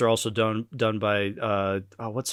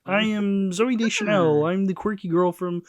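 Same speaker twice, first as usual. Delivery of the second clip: slow, high put-on frog voice; Kermit the Frog voice; 4 semitones higher, slower than usual. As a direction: introduce yourself as a goofy puppet. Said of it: "stop doing the current voice".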